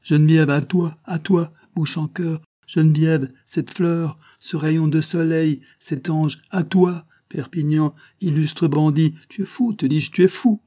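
put-on high, loud, incredulous old man; scornful disbelief, pitched well up; scornful outburst. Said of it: "partit d'un rire nerveux et haussa les épaules".

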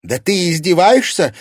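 high, loud furious cry; furious shout at husband; angry shout. A narrator's reading. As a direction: shout through the door. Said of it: "зло крикнула Ангелина".

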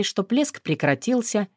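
plain reading voice

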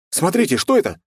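high, loud call from the crowd; shout from crowd. Acted. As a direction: call out in alarm. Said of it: "выкрикнул кто-то из толпы".

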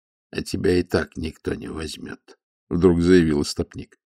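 usual speaking voice

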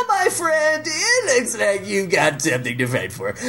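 high, smarmy game show host; oily host, milking the moment. Retold or imitated. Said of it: "his smarmy game show voice".

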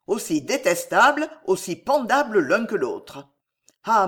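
usual speaking voice

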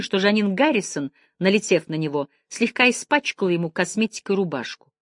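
ordinary delivery, nothing marked

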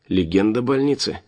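usual speaking voice

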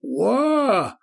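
high, drawn-out whoop; stretched, high exclamatory call; quoting father's exclamation. A narrator's reading. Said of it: "как говаривал мой папа".

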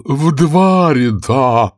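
high, long-drawn cry; drawn-out excited turtle call; cried out. A narrator's reading. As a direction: call it out, stretching every word. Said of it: "закричал черепаха Квази".

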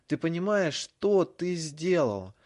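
loud, high, slow furious yell; shouting. A narrator's reading. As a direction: scream it at him. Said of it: "Его голос перешёл на крик".

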